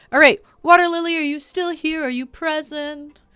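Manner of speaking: loud, fast call to chat. Real, not acted. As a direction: shout the name to get attention. Calling out to a chat viewer.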